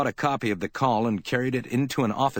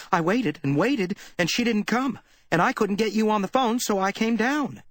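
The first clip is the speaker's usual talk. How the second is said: put-on high, anxious young woman's voice; worried Effie; 13 semitones higher than usual. A narrator's reading. Effie telling Spade she waited, anxious and high. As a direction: rush it out, scared and upset.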